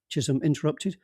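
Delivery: ordinary delivery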